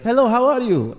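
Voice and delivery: put-on Middle Eastern accent. Immigrant dad greeting people, Middle Eastern accent. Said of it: "he still has an accent".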